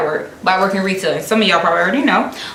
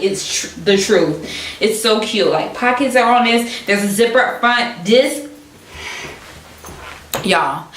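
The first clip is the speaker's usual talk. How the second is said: usual speaking voice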